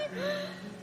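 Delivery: sharp high gasp of joy; delighted gasp. Acted. Goddess restored to her body gasps in delight. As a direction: gasp as if she can't believe it.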